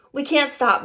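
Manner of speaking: plain reading voice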